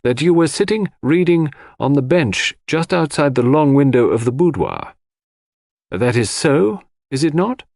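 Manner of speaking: lightly voiced coroner laying out each detail deliberately. A narrator's reading. Coroner presses Mary about where she sat, deliberately. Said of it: "continued the coroner deliberately".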